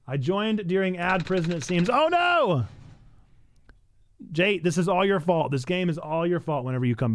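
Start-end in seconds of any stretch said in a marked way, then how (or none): 1.9-2.6 high sudden oh no; a real startled cry; real dismay. Real, not acted. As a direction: react in shock. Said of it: "streamer reacting".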